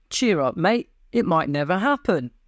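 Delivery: usual speaking voice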